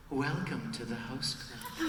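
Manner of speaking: soft, slow, hushed delivery; very quiet. Shown as a demonstration. Feeding a nervous fan the line to repeat, very quietly. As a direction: say it very quietly, unhurried. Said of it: "say something very quietly".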